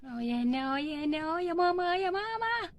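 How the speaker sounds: soft moan climbing in pitch; pained moaning. Acted. Woman in labour calling for mama, pained moaning. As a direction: moan through a rising contraction.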